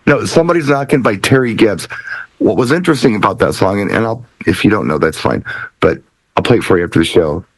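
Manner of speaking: ordinary talk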